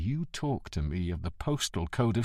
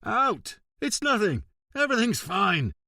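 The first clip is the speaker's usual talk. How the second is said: yelling; 9 semitones higher than usual. Acted. Yelling staff out.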